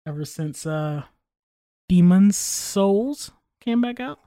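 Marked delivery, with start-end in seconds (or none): 1.9-3.3 DEMON'S SOULS slow and carefully enunciated; over-articulated. Real, not acted. Gamer showing off his new diction, over-articulated. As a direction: draw out each word of DEMON'S SOULS crisply. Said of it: "starting to articulate words more".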